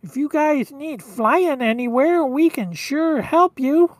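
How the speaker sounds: eager reindeer with a high, whiny voice; whiny, nasally Rudolph. Acted. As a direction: offer help in a nasal whine. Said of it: "he's got that kinda really whiny, nasally voice".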